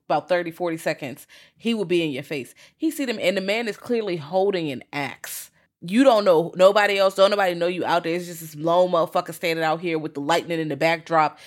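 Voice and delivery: ordinary talk, nothing marked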